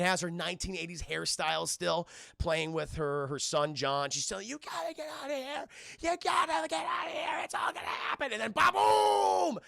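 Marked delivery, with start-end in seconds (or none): 4.5-8.3 high, urgent shouted warning; mock frantic yell; desperate warning. Sarah screaming warning in dream. 8.3-9.6 explosion sound. Retold or imitated. Explosion in story.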